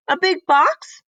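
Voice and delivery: high, loud surprised query; surprised question. Acted. Miss Reed hears about the box, high and surprised. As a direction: catch on suddenly.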